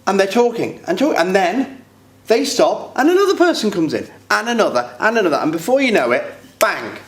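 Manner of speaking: pitched high, racing towards an abrupt stop; exasperated build-up. Acted. Man recounting each new person cutting in, exasperated. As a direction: pile them up in a high, worked-up voice, then drop. Acted build-up as others keep talking.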